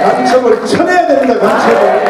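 loud preaching, stressing 쳐내야; shouted preaching. Real, not acted. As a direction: shout it at the congregation.

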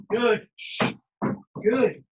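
ordinary talk

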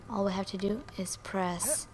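soft voiceless aside; whisper. Real, not acted. Gamer whispering the controls to herself. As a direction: whisper the button hint. Reading game instruction.

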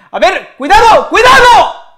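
very high, loud warning yell; alarmed shout. Real, not acted. Fan seeing a Polish attack, shouting cuidado. Danger on the pitch.

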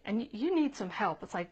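normal talking voice

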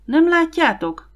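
a high, loud plea to puzzled friends; shouting to friends. A narrator's reading. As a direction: make them see it, call across the room. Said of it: "kiáltott Lars értetlen társainak".

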